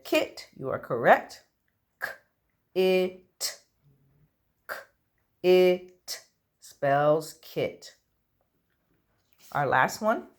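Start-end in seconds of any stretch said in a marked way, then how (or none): 2.7-7.9 p-a-t, each sound stretched and separate, loud. Shown as a demonstration. Teacher sounding out a word for children to guess, loudly. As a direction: sound out every letter slowly. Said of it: "I'm going to sound out the words".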